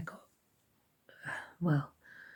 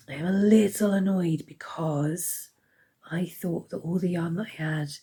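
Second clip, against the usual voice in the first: hoarse whisper. Real, not acted. Sick vlogger with failing voice.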